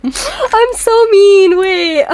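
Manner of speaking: high, loud, drawn-out wail; guilty squeal, half laughing; laughing guilt. Real, not acted. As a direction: squeal it through giggles. Laughing guilt at forgotten vote.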